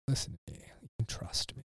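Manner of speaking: hushed, breathless coaxing whisper; whispered serpent. Retold or imitated. Serpent coaxing Eve to trust him, in a whisper. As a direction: whisper it like a tempting snake.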